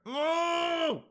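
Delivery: long, high-pitched scream of refusal; drawn-out comic "no" at the top of the voice; mock anguished scream. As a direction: wail it out in exaggerated horror. Acted outrage at suggestion to share.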